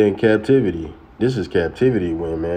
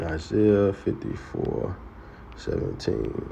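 softly repeated; 8 dB quieter, slower than usual. Shown as a demonstration. Preacher softly repeating the verse reference to himself. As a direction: murmur the reference while finding it.

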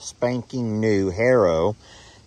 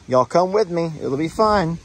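upbeat, higher sing-song call to the dogs; cheery dog call; 7 semitones higher than usual. Real, not acted. Owner calling his dogs to come along, cheery higher voice. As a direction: coax the pups along brightly.

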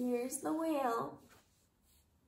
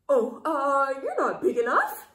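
loud, flustered brush-off; blurted loud excuse; 7 dB louder than usual. A narrator's reading. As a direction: bluster out of the fight. Ladybug backs down loudly.

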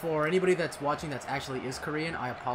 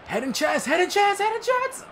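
rising higher and louder each time; building excitement; 10 dB louder than usual. Real, not acted. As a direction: build the chant to a yell. Building chant during play.

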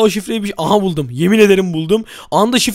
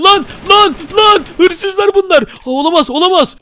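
high, loud alarmed outburst; mock panic; 8 semitones higher, 4 dB louder than usual. Gamer stumbles on the thieves, high loud mock panic. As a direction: cry out in exaggerated alarm.

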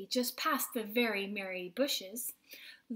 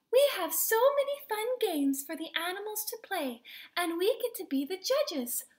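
eager, higher storybook voice; excited exclamation; 6 semitones higher, 3 dB louder than usual. A narrator's reading. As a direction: share the fun news eagerly. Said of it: "Lulu exclaimed".

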